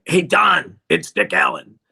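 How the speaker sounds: Dick impression. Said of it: "I do a better Dick".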